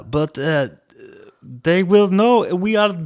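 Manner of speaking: high, loud and slow mock Russian sailor; over-the-top high Russian accent. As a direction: ham up a Russian officer in a high voice. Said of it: "the other guy's like".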